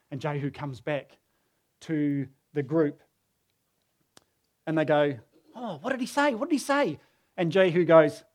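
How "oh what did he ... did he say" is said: high-pitched, nosy chorus of commanders; eager questioning. Retold or imitated. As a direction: pester him for the news, voice pitched up. Commanders pressing Jehu for news.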